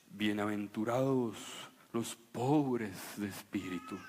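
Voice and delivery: low, slow, mock-pompous sermon tone; solemn preacher voice. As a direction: ham up a gloomy pulpit voice. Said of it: "en voces y en sermones que no evocan felicidad".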